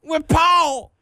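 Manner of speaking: high put-on country twang; mock hillbilly. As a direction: tease like a backwoods local. Country voice bit.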